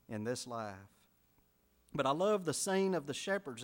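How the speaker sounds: usual speaking voice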